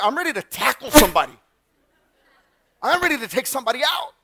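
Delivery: fired up to fight, voice rising; pumped-up readiness. Retold or imitated. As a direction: re-enact an adrenaline-fuelled charge. Retelling his charge to the rescue.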